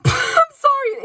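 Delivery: high, loud squeal of laughter; real helpless laugh, drawn out; laughing apology. Real, not acted. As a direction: burst out laughing through the apology. Tries not to laugh, apologizes.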